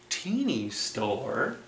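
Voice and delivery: soft gasp, then a hushed question; amazed whisper of discovery; breathy wonder; gasped surprise. Acted. Gasp at the sign.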